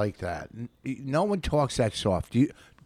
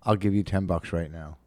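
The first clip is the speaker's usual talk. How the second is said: usual speaking voice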